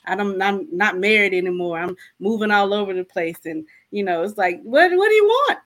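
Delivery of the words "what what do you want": much higher and louder, exasperated demand; angry cry to God. Retold or imitated. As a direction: throw the question up at God in frustration. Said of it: "I was mad at God".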